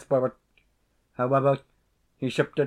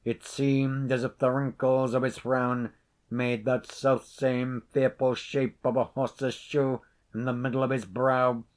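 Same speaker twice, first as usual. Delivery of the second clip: ordinary delivery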